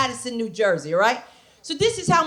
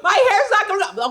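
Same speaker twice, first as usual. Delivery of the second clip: much higher, frantic, faster wail; panicked; 9 semitones higher than usual. Retold or imitated. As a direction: freak out about falling hair. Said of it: "I was like".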